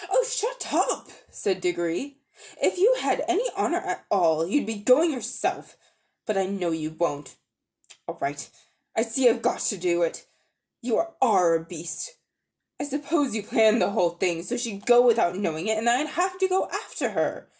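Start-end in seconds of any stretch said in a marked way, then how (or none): 0.1-1.1 indignant retort. Acted. Boy snaps at scheming uncle. 2.6-17.5 indignant accusation. Acted. Boy confronts wicked uncle.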